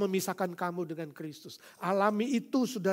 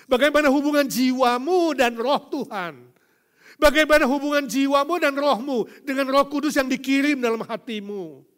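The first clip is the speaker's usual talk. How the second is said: loud, high preaching climax; rising fiery sermon; preaching at full force; 8 semitones higher, 15 dB louder than usual. Acted. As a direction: thunder it from the pulpit. Pleading loud questions.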